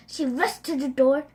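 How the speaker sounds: ordinary delivery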